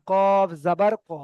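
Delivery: কফ জাবার ক slowly with rising end on short ক; slow drilled reading. Shown as a demonstration. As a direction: model কফ জাবার ক at half speed. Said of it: "কফ জাবার কা হবে না". (ধীরে কফ জাবার ক, শেষে ছোট ক)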